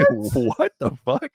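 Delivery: high, slow, baffled what the fuck; stunned crowd. Retold or imitated. Crowd hears him announced as Princess, stunned and high. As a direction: play the confused crowd stretching it out. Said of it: "I could hear everyone go".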